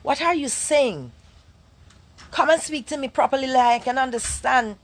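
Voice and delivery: high, loud parental telling-off; scolding a child. Retold or imitated. Mother pulling her daughter up on her speech, scolding. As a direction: check her sharply, pitched right up. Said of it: "I check her and ask her".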